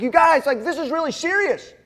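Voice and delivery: high, loud mock scolding; retold angry yelling. Dad retelling his outburst at laughing kids, angry yelling. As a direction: yell it like a fed-up parent. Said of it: "I'm yelling at my kids".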